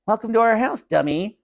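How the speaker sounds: high mocking welcome; gleeful kidnapper taunting a dummy; taunting boy.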